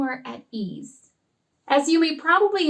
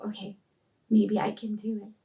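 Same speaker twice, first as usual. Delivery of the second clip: soft, low, hesitant thought; hushed, unsure self-talk; tentative shy child; 4 semitones lower, 6 dB quieter than usual. Acted. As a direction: say it quietly, as if working up courage. Voices shy girl's tentative thought.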